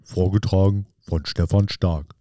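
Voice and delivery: slow, low, soft poetry reading; poem recitation. Acted. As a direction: recite it slowly and softly, pausing after each short phrase.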